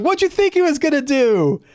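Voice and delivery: incredulous teasing; high mocking table voice. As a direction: friends mocking the obvious mistake. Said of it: "everyone else is like".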